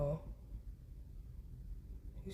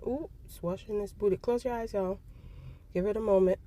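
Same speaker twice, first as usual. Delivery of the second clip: playful alarm; 5 semitones higher, 4 dB louder than usual. Real, not acted. Bird washing its rear.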